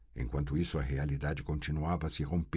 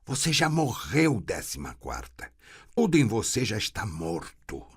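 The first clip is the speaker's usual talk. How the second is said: cruel verdict; 5 semitones higher, 4 dB louder than usual. A narrator's reading. Voice declaring death.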